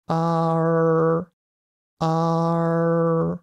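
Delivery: long あー bending into "rrr" as the tongue curls; "ah" curling into R. Shown as a demonstration. Teacher showing the practice step, "ah" into retroflex R. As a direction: hold あー, slowly curl the tongue tip back. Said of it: "あーを言いながら、 舌先を裏に巻きます".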